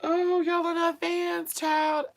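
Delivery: slow, very high squeal; high amused wail. Real, not acted. Teasing the viewers, high amused wail. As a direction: draw it out high, overcome with amusement.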